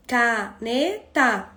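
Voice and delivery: caneta very slowly, ca-ne-ta; slow, chunked "Caneta" with pauses between syllables; split into syllables. Shown as a demonstration. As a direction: break "Caneta" into three spaced beats. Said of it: "Quantos pedacinhos tem?".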